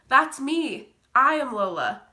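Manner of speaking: high, very loud cry of recognition; screamed announcement. Acted. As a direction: shout out who you are, overcome. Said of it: "I screamed".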